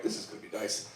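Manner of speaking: hushed, breathy whisper to himself; whispered aside. Retold or imitated. Naive newcomer whispers to himself in the cell, hushed. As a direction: whisper it like a private hope. Naive inner thought in jail.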